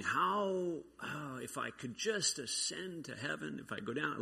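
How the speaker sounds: a despairing sigh of a thought; a lightly voiced fretting plea to heaven; anxious inner plea. Voicing his anxious inner thoughts.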